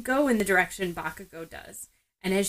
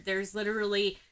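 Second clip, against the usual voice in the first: ordinary delivery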